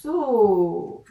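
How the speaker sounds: loud, long stretched "So"; sounded out. Shown as a demonstration. Teacher writing together with the pupil, drawn-out "So". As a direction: hold the vowel of "So", loudly. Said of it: "je dis bien tous les sons".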